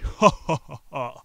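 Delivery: loud, high mocking ha-ha; hammed-up demon cackle; mocking demon laugh. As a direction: laugh at him with scorn. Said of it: "the demon began laughing in mockery".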